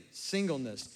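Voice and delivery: ordinary talk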